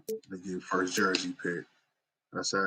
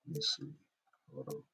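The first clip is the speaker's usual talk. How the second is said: ordinary talk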